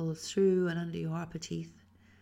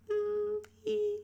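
mm and E sung very high; 4 dB louder than usual. Shown as a demonstration. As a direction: float it up to a high tone. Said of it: "when you go up high".

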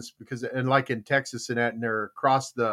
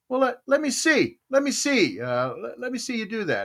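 higher, skeptical warden; game warden; 8 semitones higher than usual. Retold or imitated. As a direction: call the bluff. Warden voiced in joke.

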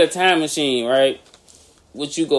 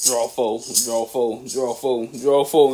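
deliberate countdown; 5 semitones lower, 4 dB quieter, much slower than usual. Acted. Imagined card stack going round.

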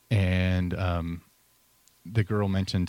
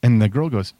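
ordinary talk, nothing marked

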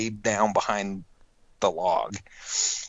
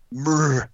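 short guttural elk murr; imitated elk grunt; 5 semitones higher, 5 dB louder than usual. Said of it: "a guttural thing".

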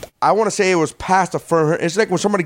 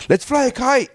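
mock cheerful idea; 8 semitones higher than usual. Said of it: "somebody goes".

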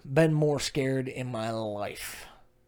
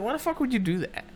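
high-pitched, hurried outburst; mad; 11 semitones higher, faster than usual. Acted. Husband to wife after she smacked his beer, mad. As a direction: fire it off much higher and faster. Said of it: "but I did get mad".

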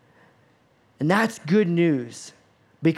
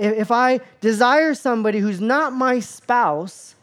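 higher-pitched, stammering disbelief; acted incredulity; 5 semitones higher, 3 dB louder than usual. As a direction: play a flustered listener questioning what he just heard. Imagined listeners question Jesus, incredulous.

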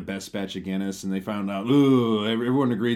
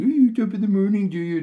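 much higher and louder, a mocking fake Irish accent; bad Irish accent mockery; 11 semitones higher, 7 dB louder than usual. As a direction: parody a TV newsman's cheesy Irish accent. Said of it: "doing really bad Irish accents".

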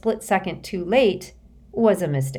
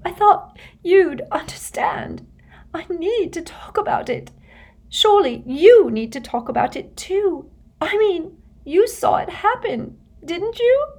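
tearful, much higher and pleading; distressed; 13 semitones higher, 4 dB louder than usual. A narrator's reading. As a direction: plead through tears, high and rising. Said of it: "tears spattering down onto the table".

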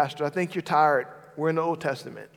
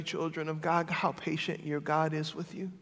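ordinary delivery

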